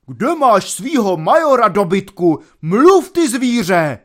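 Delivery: loud, high bellow at a servant; furious roar. A narrator's reading. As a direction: roar it out like an enraged general. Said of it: "řval na něho".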